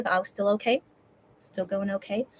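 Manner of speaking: normal talking voice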